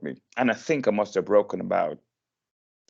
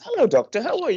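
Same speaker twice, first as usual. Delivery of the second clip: high cheery hello to the doctor; bright greeting; 14 semitones higher, much faster than usual. Retold or imitated. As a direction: greet the doctor much higher. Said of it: "And I says".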